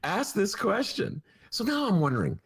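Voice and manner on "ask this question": mock aide's request. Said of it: "It was just".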